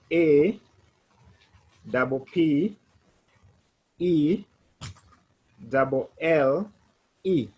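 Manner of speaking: letters spelled one by one; spelling it out. Shown as a demonstration. Teacher spelling je m'appelle letter by letter. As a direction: dictate each letter with pauses. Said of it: "The letters are J".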